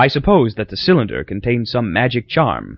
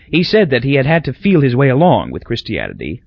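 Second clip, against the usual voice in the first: ordinary talk, nothing marked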